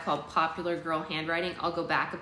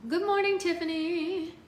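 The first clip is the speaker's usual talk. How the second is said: cheery high greeting; 12 semitones higher than usual. Real, not acted. Greeting a chat viewer.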